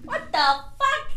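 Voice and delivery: slow, high outraged squeal. Retold or imitated. Mock outrage echo.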